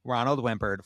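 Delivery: ordinary talk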